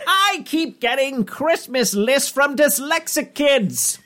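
high, very slow cartoon villain screech; Skeletor. Satan complaining in a tweet, high villain screech. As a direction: whine it high, dragging every word.

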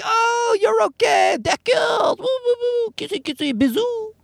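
very high, squealing gush with French flair; gushing French people. Retold or imitated. Comic mimics French people warming up to his wife, high squealing gush. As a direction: squeal it like overjoyed Parisians air-kissing. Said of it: "They're all like".

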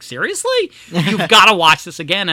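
retold astonished insistence; mock disbelief. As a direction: imitate friends who can't believe it, voice leaping up. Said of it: "And they're like".

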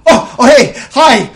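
loud, high excited greeting; over-the-top cheerful hello; excited burst. Acted. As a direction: burst in beaming with joy.

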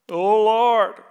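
loud, overblown pious "Oh, Lord"; showy prayer. Retold or imitated. Showoff believer praying for an audience, loud and pompous.

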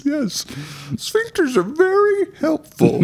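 high prissy squeak for a talking sphincter; high offended sphincter voice. Pretend. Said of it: "Why do you talk like that?".

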